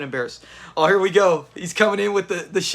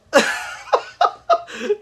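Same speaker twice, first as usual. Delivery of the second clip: high burst of laughter; real laughter at Lamont; 13 semitones higher than usual. Real, not acted. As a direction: crack up high.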